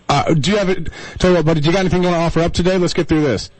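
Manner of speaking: ordinary talk, nothing marked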